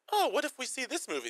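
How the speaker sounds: lifted high, wide-eyed suggestion; mock girlfriend voice. Mocking the girlfriend asking her boyfriend about a film, high and eager.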